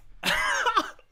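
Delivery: very high squealing laugh of delight; shrieking laugh. Real, not acted. Fan after a stunning goal, squealing laugh. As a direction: squeal with joy.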